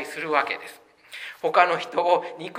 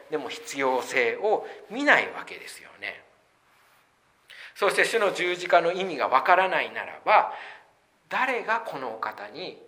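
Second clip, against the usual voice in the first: ordinary talk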